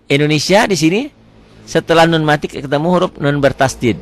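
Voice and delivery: usual speaking voice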